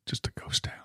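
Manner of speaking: hushed mock-spooky whisper; eerie whisper about a deserted forum. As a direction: whisper it like an empty ghost town. Whispering about empty rival site.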